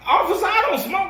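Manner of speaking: high pitched disbelieving protest; indignant protest. Retold or imitated. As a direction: sound shocked at the accusation. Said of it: "I'm like".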